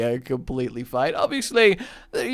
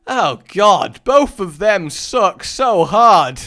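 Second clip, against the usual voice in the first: loud exasperation; 7 semitones higher, 10 dB louder, slower than usual. Real, not acted. Disgusted at bad items.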